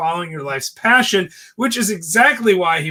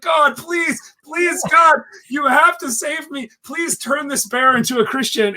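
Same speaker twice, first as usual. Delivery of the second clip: high, pleading mock prayer, much higher; hammed-up desperate prayer; 8 semitones higher than usual. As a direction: beg God in a comic panic, pitched up. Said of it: "He's like".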